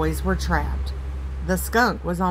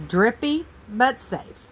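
ordinary delivery, nothing marked

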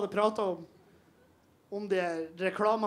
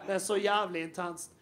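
ordinary delivery

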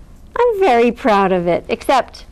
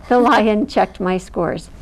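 ordinary delivery, nothing marked

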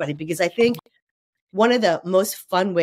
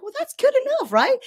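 exasperated retort. Retold or imitated. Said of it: "I'm like".

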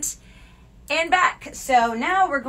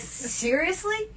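retold disbelief; 10 semitones higher, much slower than usual. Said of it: "I'm like".

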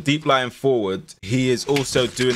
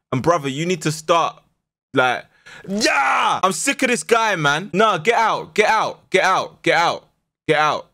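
angry rant; 8 semitones higher than usual. Real, not acted. Furious at injured player.

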